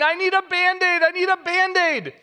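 high, mock-tearful little girl; hammed-up child's sob; imitating sobbing child. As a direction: wail it like a crying toddler. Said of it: "through her tears, just sobbing".

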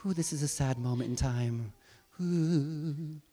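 a touch of polite, understated sadness; Mary mildly sad. Pretend. Preacher imagining Mary beside herself, mildly sad. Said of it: "she's just kind of beside herself".

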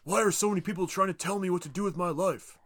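hammed-up frustrated protest. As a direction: complain like a fed-up man being retold. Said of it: "he gets frustrated 'cause he's like".

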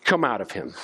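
Jesus ordering the demon out, low and firm; stern command. Retold or imitated. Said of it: "Jesus said sternly".

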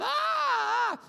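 high hammed-up moans of his wife; mock labor groans. Preacher imitating his wife's contraction moans. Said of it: "she goes".

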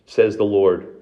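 ordinary talk

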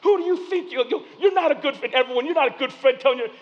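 hammed-up angry comeback; mock angry retort. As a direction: fire back offended. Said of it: "I got mad".

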